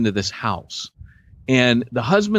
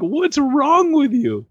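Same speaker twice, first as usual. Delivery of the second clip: mock laughing wife; 12 semitones higher, slower than usual. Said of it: "she starts laughing".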